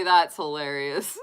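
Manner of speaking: usual speaking voice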